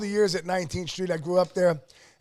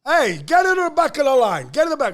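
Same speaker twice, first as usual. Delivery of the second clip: dad's gruff order, a little louder; retold dad's order; 5 semitones higher, 6 dB louder than usual. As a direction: bark it like an old pizzeria owner. Dad ordering customer to line.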